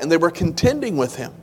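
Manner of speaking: usual speaking voice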